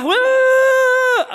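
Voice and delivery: long, very high mock wail; possessed wail. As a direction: shriek out one held note as if possessed. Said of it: "Itu kesurupan namanya". (jeritan kesurupan pura-pura)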